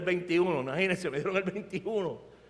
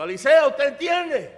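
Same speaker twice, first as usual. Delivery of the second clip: loud, higher sergeant's challenge; raised-voice military questioning; barking sergeant; 7 semitones higher, 9 dB louder than usual. Retold or imitated. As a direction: demand an answer like a drill sergeant. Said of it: "el sargento".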